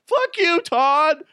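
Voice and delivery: high snapping comeback; angry coworker retort. Pretend.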